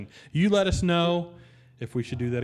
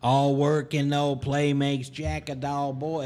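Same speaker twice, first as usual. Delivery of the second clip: a hint of Jack Nicholson, slow; lightly voiced, slowed-down Nicholson; Jack Nicholson impression; slower than usual. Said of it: "the worst Jack Nicholson ever".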